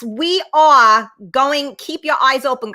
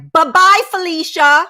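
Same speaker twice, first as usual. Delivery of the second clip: high, loud sing-song send-off; pitched-up, playful kiss-off; mock dismissal; 6 semitones higher, 5 dB louder than usual. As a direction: wave them off with a sassy, lilting goodbye. Mock dismissal of bad types.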